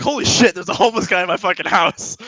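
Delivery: high, loud mock panic; freaking out. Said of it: "Now I'm freaking out".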